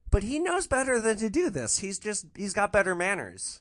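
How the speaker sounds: ordinary delivery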